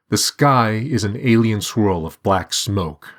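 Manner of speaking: usual speaking voice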